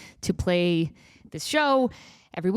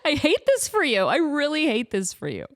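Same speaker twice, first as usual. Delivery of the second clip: laughing mock pity; 6 semitones higher than usual. Laughing mock sympathy to kids.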